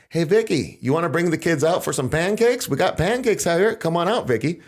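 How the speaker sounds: fake-friendly bullhorn call; mock bullhorn taunt. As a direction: mimic agents calling out sweetly over a megaphone. FBI taunting through bullhorn.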